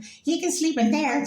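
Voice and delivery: very high, slow mock mother voice; imitating mom. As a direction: play mom assigning rooms, high and drawn out. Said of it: "my mom was like".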